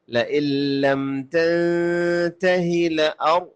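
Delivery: slow, melodic tajweed chant with long held vowels; Quran recitation. Shown as a demonstration. Teacher recites the verses for learners, measured Quranic chant. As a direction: chant each phrase in sustained tones with full pauses.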